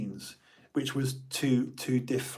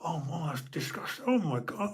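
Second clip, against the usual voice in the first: higher, half-whispered mock revulsion; hammed-up disgust; 4 semitones higher, 6 dB quieter than usual. Schoolboy making a big scene about the fart, mock disgust. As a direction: overact the disgust. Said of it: "you do this big scene".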